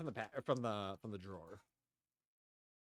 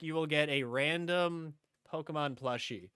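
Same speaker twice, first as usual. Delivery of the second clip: normal talking voice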